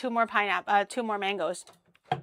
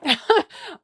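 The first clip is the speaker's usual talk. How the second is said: burst of laughter; 8 semitones higher, 8 dB louder than usual. Real, not acted. Laughs at herself.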